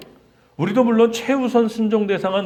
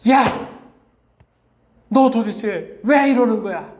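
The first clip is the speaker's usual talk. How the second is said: put-on much higher, louder, slow furious yell at a lost child; angry scolding; 6 semitones higher, 4 dB louder, slower than usual. Frantic parent finding the lost son, angry shout. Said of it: "하면서 아마 책망했을지도 몰라요".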